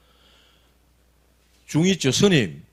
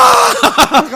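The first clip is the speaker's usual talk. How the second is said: loud burst of laughter; 8 dB louder than usual. Retold or imitated. After crying out to mother.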